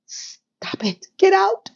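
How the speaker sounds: whispered then high alarm; body's panicked voice. Retold or imitated. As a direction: hiss it, then yelp the get out. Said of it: "My body was like".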